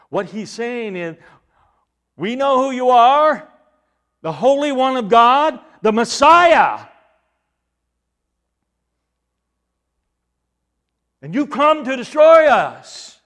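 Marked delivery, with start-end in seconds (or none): 2.2-13.2 loud, high cry of a cornered evil spirit; demon's outcry. Acted. Demon confronting Jesus in a retold Bible story, loud outcry. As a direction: cry it out loudly, on a raised pitch.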